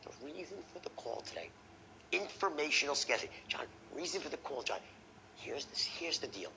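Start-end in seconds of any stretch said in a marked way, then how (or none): 0.0-1.5 lowered, very soft confidential voice; scarcity tone. Shown as a demonstration. Salesman opening a call to John, hushed scarcity. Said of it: "lowering your voice". 3.5-4.8 quick, hushed confidential delivery; scarcity tone. Shown as a demonstration. Salesman giving John scarce information, hushed. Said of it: "Lower the voice".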